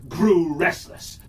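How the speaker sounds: usual speaking voice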